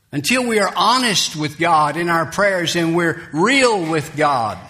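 usual speaking voice

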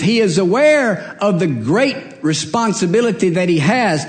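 fervent pulpit storytelling, key words stretched; impassioned preaching. Acted. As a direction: build in pitch as you preach the story. Preaches Solomon's story at height.

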